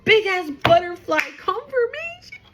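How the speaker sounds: thrilled by a sign; real giddy excitement; excited outburst. Real, not acted. Spots a butterfly mid-reading.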